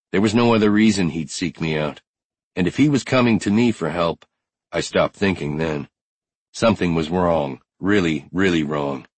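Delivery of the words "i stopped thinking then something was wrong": usual speaking voice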